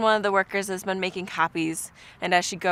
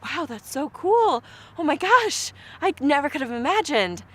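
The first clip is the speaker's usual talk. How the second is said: high, louder amazed gasp of joy; retold thrilled reaction, pitched up and loud; amazed delight; 8 semitones higher, 4 dB louder than usual. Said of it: "And I was like".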